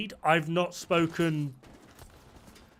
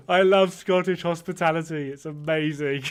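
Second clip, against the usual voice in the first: laughing; 2 semitones higher than usual. Real, not acted. Laughing after the skit.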